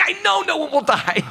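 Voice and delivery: high-pitched, exasperated mock squeal; exasperated retort. Client answering the palm reader's familiar reassurance, exasperated. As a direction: throw it back with pitch shooting way up.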